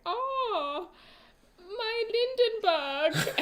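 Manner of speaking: high, loud, drawn-out mock German cry; cartoon German accent. Simpsons character who is just German, cartoon German accent. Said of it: "saying things in a German accent".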